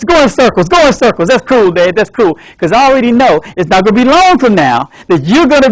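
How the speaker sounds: overeager son at bedside. Acted. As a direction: talk your sick dad up with forced pep. Son visits dad in wheelchair.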